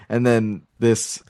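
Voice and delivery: ordinary talk, nothing marked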